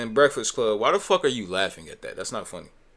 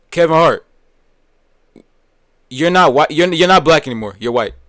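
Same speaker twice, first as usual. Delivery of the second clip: loud, raised-pitch scolding; angry denunciation; 4 semitones higher, 11 dB louder than usual. Real, not acted. Streamer disowning the comedian, loudly indignant. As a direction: call him out forcefully, as if to his face.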